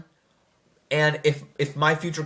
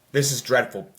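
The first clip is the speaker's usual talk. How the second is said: ordinary talk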